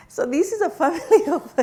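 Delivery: laughing through the words, pitch jumping up. Real, not acted. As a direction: break into a real laugh mid-sentence. Absurd size of struggling family.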